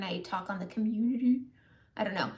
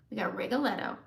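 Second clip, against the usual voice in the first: ordinary talk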